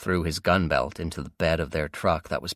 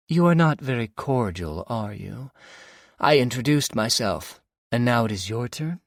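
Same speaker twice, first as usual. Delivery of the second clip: higher, louder taunting woman's voice; mocking villainess; 6 semitones higher, 4 dB louder than usual. Jez taunting silent James, mock-offended villainess. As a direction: tease him for his rudeness.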